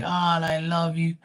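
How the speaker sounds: mocking sing-song. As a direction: son teasing mom for her new habit, mocking. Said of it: "we used to tease the shit out of her".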